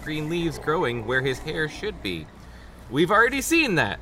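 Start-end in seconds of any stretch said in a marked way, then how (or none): 2.9-4.0 mildly irritated, pitched-up retort; acted touch of exasperation; snapping. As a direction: snap it impatiently. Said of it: "Margaret snapped".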